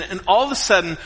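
normal talking voice

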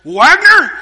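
loud, high angry call of a name; irate neighbor. Retold or imitated. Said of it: "he is irate".

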